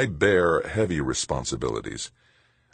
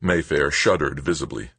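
ordinary delivery, nothing marked

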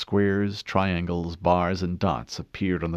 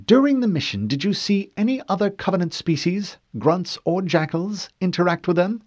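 high, precise questioning woman; cool female interrogator; 12 semitones higher, 5 dB louder than usual. Acted. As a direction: question him coolly.

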